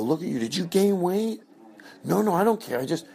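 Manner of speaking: high, nosy backhanded host; fake party host. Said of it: "I go".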